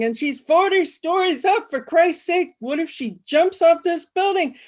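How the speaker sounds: squawking panic. Acted. Flustered guy squawking about the woman on the tower. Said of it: "flapping and squawking".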